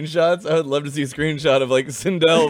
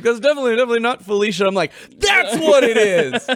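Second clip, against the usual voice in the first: excited; 8 semitones higher, 5 dB louder than usual. Retold or imitated. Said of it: "you're like".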